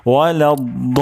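weighty 'daa' stretched out in ওয়ালাদ; heavy daad. Shown as a demonstration. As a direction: chant ওয়ালাদ with a thick d, vowel held very long. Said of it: "তাহলে দদ মোটা হবে". (মোটা দদে লম্বা টানে ওয়ালাদ)

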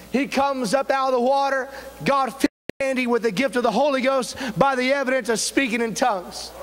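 fervent preaching. Acted. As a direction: build to a ringing declaration of the call. Preaches the closing at full force.

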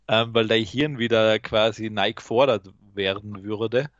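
normal talking voice

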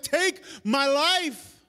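drawn-out, loud plea; slow despairing plea. Retold or imitated. Elijah before the Lord, slow despairing plea. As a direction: beg slowly, worn out. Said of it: "He's like".

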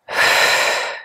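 long, audible breath out through pursed lips; mouth exhale. Shown as a demonstration. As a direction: blow the air out steadily. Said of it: "Exhale, mouth".

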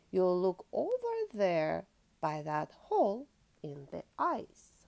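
lively storybook reading aloud; reading to children. Acted. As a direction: read it like a bedtime picture book. Reads picture book to child.

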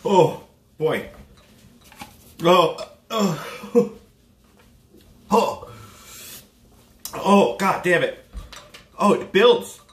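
pained groans from the heat; mouth burning from spicy food. Real, not acted. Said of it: "My tongue is on fire".

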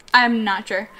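ordinary delivery

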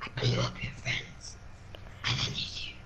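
cold unvoiced whisper; whispering villain. Villain telling the partygoer about his friends, sinister whisper. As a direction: whisper the threat without any voice. Villain answers what he did.

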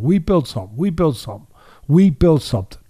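"We built something" repeated, each time hitting "We"; stress on we. Shown as a demonstration. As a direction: insist on "We", correcting himself. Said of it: "No, that would be wrong".

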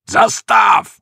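high, loud bandit roar; full-throated halt shout. A narrator's reading. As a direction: bellow the order to halt. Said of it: "zařval".

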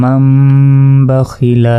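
long hummed m in "মাম", long final a in "খিলা"; recited with ghunna. Shown as a demonstration. Teacher reciting the whole word, with ghunna. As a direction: hold the m of "মাম" and draw out the end of "খিলা". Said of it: "গুন্নার সাথে".

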